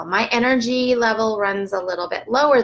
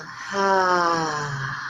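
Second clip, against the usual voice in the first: audible exhale; 3 semitones lower than usual. Shown as a demonstration. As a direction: demo exhale.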